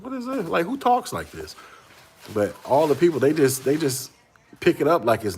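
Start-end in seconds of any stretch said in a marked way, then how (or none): 0.0-1.5 high-pitched baffled question at the start; mock bewilderment at the slang; incredulous. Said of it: "I'm like".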